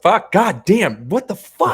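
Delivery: loud high cursing outburst; frustrated shout. Real, not acted. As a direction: explode at yourself for the slip. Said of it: "I'm upset".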